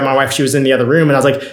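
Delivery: usual speaking voice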